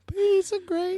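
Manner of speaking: high silly squeak; squeaky voice. Pretend. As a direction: pitch way up for the joke. Squeaky callback.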